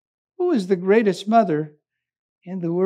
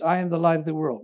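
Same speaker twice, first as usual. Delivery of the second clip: practicing his line; 6 semitones lower, 6 dB quieter, much faster than usual. Retold or imitated. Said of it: "began to practice and practice".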